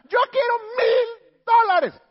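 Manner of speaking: mucho más agudo y fuerte, lento, entre llanto; grito lloroso. Acted. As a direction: berrinche de clienta exigiendo dinero. Clienta exige su dinero. (clienta a gritos llorosos exigiendo su dinero)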